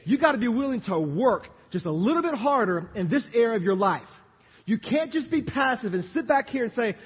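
loud, high, punchy sermon delivery; full-force preaching. Real, not acted. Preacher urging church to work harder, full-force preaching.

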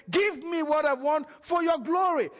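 ordinary talk

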